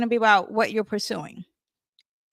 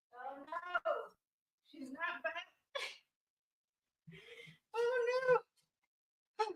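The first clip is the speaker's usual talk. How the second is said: far off; 8 semitones higher, 17 dB quieter than usual. Real, not acted. Far-off voice while away.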